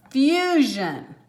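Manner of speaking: "fusion" drawn out as FYOO-zhun; long vowel. Shown as a demonstration. Teacher sounds out the word, "fusion" with long U. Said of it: "make it a long sound".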